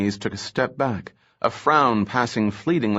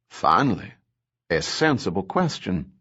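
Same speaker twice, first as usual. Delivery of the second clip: usual speaking voice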